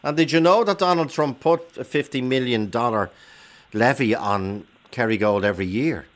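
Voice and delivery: normal talking voice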